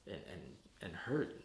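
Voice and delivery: ordinary talk, nothing marked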